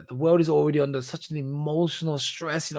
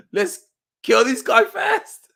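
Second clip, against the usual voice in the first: mock soldiers; 6 dB louder, much slower than usual. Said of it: "might be like".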